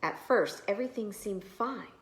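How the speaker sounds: plain reading voice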